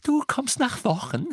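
very high, incredulous tirade; hysterical, indignant shriek; shrill outrage. A narrator's reading. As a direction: screech the question in disbelief. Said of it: "ein schrilles, unbeherrschtes Lachen".

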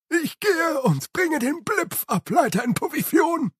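high, eager servant's cry; excited Igor. Acted. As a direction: Igor dashing off thrilled. (hohe, aufgeregte Igor-Stimme)

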